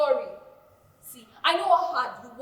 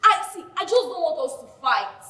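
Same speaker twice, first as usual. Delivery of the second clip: loud, exasperated giving in; 2 semitones higher, 10 dB louder than usual. Acted. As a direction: throw up your hands, loudly. Backing down in loud argument.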